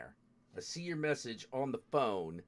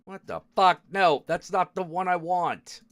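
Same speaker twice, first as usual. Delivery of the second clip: frustrated outburst; 5 semitones higher, 8 dB louder than usual. Real, not acted. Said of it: "Oh, I'm getting so angry".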